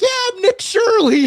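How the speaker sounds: very high, loud caricature; old man, Kermit-like voice. Retold or imitated. Old guy claiming to be Nick Shirley, Kermit-like voice. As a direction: blurt it in a squeaky frog-like old-man voice. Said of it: "the old guy would be like".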